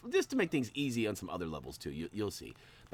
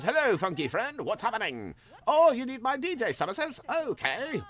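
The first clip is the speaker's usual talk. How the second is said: put-on higher, louder funky DJ accent; DJ character accent; 13 semitones higher, 5 dB louder than usual. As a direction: play a groovy DJ with an accent. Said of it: "I gave him sort of an accent there".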